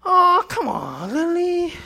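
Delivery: mock Italian husband begging his wife; Italian accent. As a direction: coax her in a hammed-up Italian voice. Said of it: "imitate you something with Italians say".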